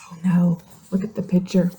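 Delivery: put-on whispered, soft aside to the kids; breathy worried whisper to young listeners; hushed aside. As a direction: drop to a whisper as if something's wrong. Teacher's hushed aside to children.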